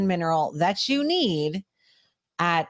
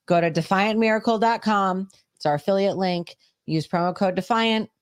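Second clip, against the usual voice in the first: upbeat sponsor read; chipper 1950s ad; 2 semitones higher, 5 dB louder than usual. Acted. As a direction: sell it like a cheerful old-time radio spot.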